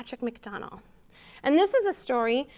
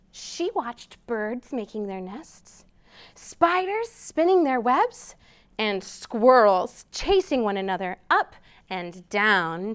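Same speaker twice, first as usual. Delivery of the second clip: gentle picture-book read-aloud; storytime reading; 5 dB louder, slower than usual. A narrator's reading. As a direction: read it slowly to little listeners.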